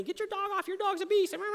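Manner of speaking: high panicked mock yell; shrill yell. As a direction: squeal at him to get his dog off. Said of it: "she's yelling at me".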